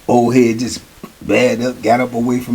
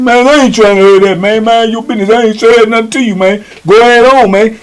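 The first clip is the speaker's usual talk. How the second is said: higher, brushing him off, loud at the end; defiant young boy; 7 semitones higher, 3 dB louder than usual. Retold or imitated. Young boy brushing off the old timer, defiant. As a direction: wave the old man away with a raised voice. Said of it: "So young boy say".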